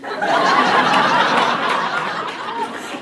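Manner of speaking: long, fierce cat hiss; drawn-out breathy hiss; scary cat hiss. Retold or imitated. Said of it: "I did my best scary cat hiss".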